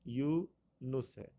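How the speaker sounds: یونس drawn out slowly, yu... nus; correct pronunciation. Shown as a demonstration. Teacher repeats the right option, slow pronunciation. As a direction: split یونس in two slow parts, ending -nus. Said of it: "جو درست option جو ہے".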